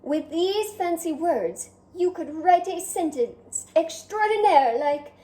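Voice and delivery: a hint of a vain French snail, chatty; lightly voiced French snail. Snail Escargot chatting with the reader, lightly French. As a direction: play the charming snail talking to the reader.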